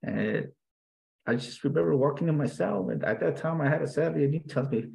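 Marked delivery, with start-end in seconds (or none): none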